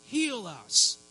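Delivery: high pleading call; crying out. Acted. Preacher as blind men begging Jesus, crying out. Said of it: "blind men crying out to Jesus".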